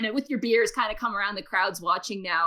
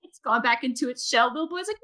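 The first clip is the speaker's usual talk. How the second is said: normal talking voice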